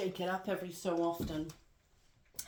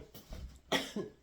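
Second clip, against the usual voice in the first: coughing. Real, not acted. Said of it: "that's a bit embarrassing".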